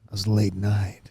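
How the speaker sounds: late night host. Pretend. Host introducing the late night talk show, smooth and hushed. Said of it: "it sounds like".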